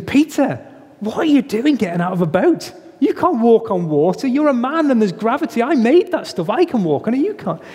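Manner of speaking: high, incredulous scolding; mock exasperated Jesus. Preacher imagining what Jesus did not say, high incredulous scold. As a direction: scold him like he's lost his mind. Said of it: "Jesus doesn't say to him".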